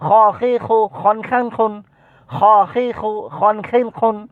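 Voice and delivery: brisk high syllable chant; sped-up drill. Shown as a demonstration. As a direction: pick up the speed through the kh syllables. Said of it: "speed বাড়াবেন".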